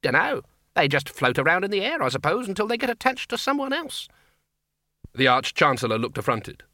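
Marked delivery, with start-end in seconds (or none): 0.0-4.1 put-on high-pitched, wondering old academic; high donnish voice, full of wonder; musing senior wizard. A narrator's reading. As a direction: marvel aloud at a curious fact. Voices the Senior Wrangler in story.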